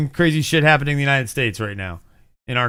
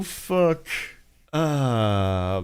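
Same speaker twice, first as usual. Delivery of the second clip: ordinary talk, nothing marked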